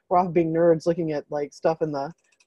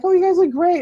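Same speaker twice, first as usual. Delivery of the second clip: gushing compliment; 11 semitones higher, 5 dB louder than usual. Retold or imitated. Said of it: "a lot of the people were like".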